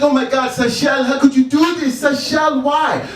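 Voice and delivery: put-on high-pitched, upset African mother accent; mock-distraught mother with an African accent; African mom accent. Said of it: "She's African, by the way, that's the accent I'm doing".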